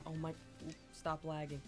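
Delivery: ordinary delivery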